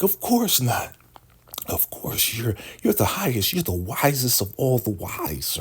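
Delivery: high-pitched fawning voice; mock kiss-ass flattery. River kissing up to the Shogun, mock fawning. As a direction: gush in a squeaky, sucking-up falsetto. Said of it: "kiss ass".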